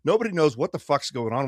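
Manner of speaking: ordinary delivery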